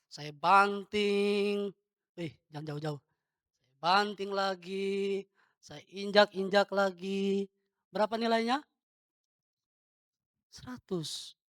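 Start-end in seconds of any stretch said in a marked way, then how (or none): 0.1-1.7 very slow, stretched throwing word; drawn-out action narration. Shown as a demonstration. Memeragakan uang dibanting. 3.7-7.5 slow, elongated play-by-play of each action; drawn-out action narration. Shown as a demonstration. Mengulang peragaan banting dan injak.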